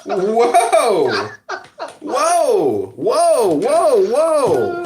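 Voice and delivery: high, loud repeated whoa with a laugh; stunned whoas. Retold or imitated. Telling his friend about the uncle's rant, laughing and stunned. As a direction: keep saying whoa in disbelief. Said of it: "repeating his reaction, laughing".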